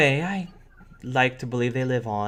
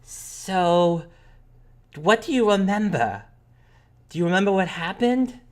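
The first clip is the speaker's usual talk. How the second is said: put-on high-pitched curious kid; high child's voice, eager question; little sister; 9 semitones higher, 4 dB louder than usual. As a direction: probe like an inquisitive child. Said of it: "Madison turns back to you and, and, and again and says".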